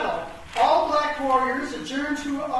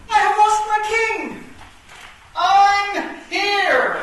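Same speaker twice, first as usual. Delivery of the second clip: loud, higher grieving cry; anguished cry; 7 semitones higher, 8 dB louder, slower than usual. Acted. Woman king mourning her king, loud anguished cry. King lost, answer called back.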